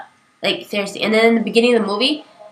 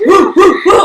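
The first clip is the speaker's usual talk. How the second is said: higher, louder repeated whoops; imitated guy cheering; 8 semitones higher, 7 dB louder, slower than usual. Guy in theater cheering, repeated high whoops. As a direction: whoop it out like an excited fan. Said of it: "some guy's like".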